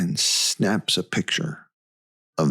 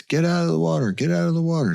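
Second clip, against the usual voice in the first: raised-pitch mock shout; retold yell; 9 semitones higher, much faster than usual. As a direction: imitate a parent calling kids out of the water. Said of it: "starts yelling at the kids".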